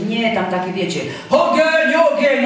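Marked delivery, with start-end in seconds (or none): 1.3-2.5 high, loud, drawn-out parody chant; mock revival hype. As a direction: mimic overheated prayer shouting. Mocks hype prayers about fire.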